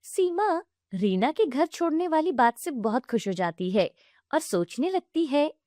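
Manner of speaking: usual speaking voice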